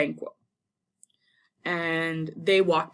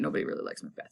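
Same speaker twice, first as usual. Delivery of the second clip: whispered under the breath; whispered aside; 8 dB quieter, much faster than usual. Real, not acted. Teacher's whispered aside to the class about Macbeth. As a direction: let it slip as a secret.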